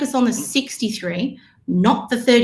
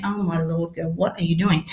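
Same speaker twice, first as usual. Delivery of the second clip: lower, softer mock exasperation; husband's disbelief; 5 semitones lower, 5 dB quieter than usual. Coach imitating her husband, low and exasperated. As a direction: groan it like a tired husband. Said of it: "And he's going".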